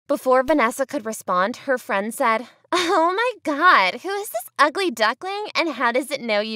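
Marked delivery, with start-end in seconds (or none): 2.7-6.6 high mocking mean-girl sneer. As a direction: sneer at the newcomer. S0 voices mean friend.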